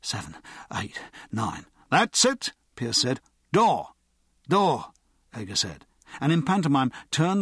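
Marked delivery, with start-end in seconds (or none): 0.0-1.7 counting low under the breath; low whisper. A narrator's reading. Ager counting to himself as he jogs in a timed drill, low murmur. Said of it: "in a low whisper". 1.9-2.5 brisk, high-pitched call. A narrator's reading. Pierce timing Ager's practice run, called out. Timed burglary drill, calling out. 3.5-4.9 high, loud one-word call. A narrator's reading. Ager and Pierce in a timed run, steps called out.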